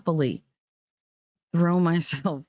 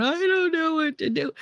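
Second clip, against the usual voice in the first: much higher, helpless mock panic; 12 semitones higher than usual. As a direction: mimic someone losing their head.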